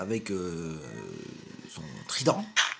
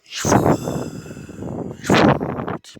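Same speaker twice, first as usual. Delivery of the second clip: whispering; 7 dB louder than usual. Real, not acted. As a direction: whisper it like in a library. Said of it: "Je parle tout bas parce que j'ai envie de parler tout bas".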